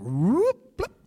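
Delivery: rising cartoon whoop and pop; playful swooping sound effect, sliding upward; cartoon sprouting sound. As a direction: imitate a plant springing up instantly. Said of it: "it's like a cartoon".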